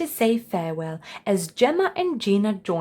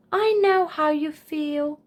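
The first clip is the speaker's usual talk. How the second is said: put-on young Charlie; 7 semitones higher than usual. A narrator's reading. Charlie talks to birds.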